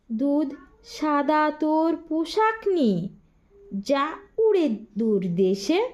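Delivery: rhythmic sing-song rhyme reading, each line set apart; measured nursery-rhyme recital; poem recitation. Acted. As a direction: recite the rhyme to first graders. Rhyme recited a second time.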